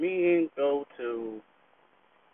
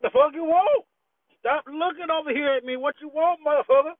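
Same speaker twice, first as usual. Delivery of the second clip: high, loud, confrontational yell at the nosy driver; retold angry yell; 9 semitones higher, 3 dB louder than usual. Man in parked car yelling at a staring neighbor, angry and loud. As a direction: snap back hard, voice jumping up high. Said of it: "and I'm looking like".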